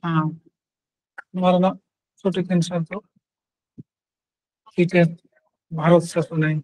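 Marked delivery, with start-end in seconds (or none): none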